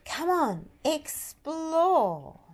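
plain reading voice

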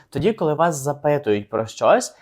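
ordinary talk, nothing marked

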